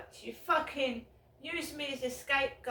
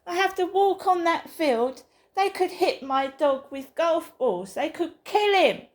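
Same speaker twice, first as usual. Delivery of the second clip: much louder, higher, shouting it out; real furious shouting; 3 semitones higher, 9 dB louder than usual. Real, not acted. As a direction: yell your fear for the dog. Furious rant about golfers.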